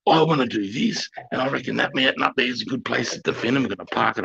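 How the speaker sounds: mock nineteen-year-old officer; keen young officer. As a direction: pitch it up like a green recruit with a plan. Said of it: "these young nineteen-year-olds".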